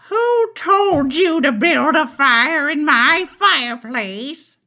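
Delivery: high, loud witch shout. Acted. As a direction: shout it as a cranky old witch. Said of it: "she shouted".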